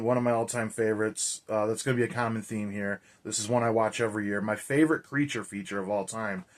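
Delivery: ordinary talk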